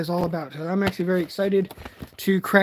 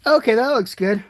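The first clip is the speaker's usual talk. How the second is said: ordinary delivery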